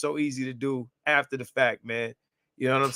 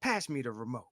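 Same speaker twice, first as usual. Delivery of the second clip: mom's casual request; 3 semitones lower, 11 dB quieter than usual. Retold or imitated. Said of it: "She be like".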